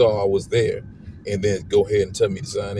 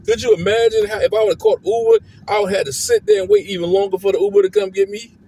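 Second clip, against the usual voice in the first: higher, louder, fast and fed up; real exasperated rant at the clinic; 12 semitones higher, 5 dB louder than usual. Real, not acted. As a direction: vent it all out, pitch climbing. Ranting about the botched appointment.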